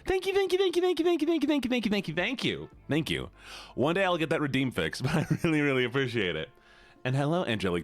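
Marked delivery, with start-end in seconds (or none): 0.1-3.3 tiny squeaky thank-yous, very high. Acted. Squeaky high thank-you streak.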